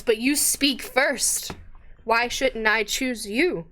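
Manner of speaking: slow, deliberate challenge; slow ogre challenge. Acted. Ogre challenges the adventurer to speak first, slow. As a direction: press him slowly.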